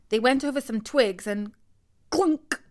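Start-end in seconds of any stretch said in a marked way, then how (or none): none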